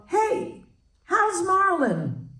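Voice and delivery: Shirley casually asking about Rita's long-dead ex, cheery and high; imitating Shirley MacLaine. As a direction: ask it brightly, oblivious. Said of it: "And she said".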